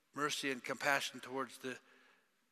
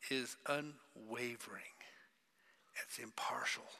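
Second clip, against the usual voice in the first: whisper; 2 semitones lower, 5 dB quieter than usual. Real, not acted. Preacher describing wisdom from above, whispered. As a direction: breathe the words out softly. Hushed emphasis.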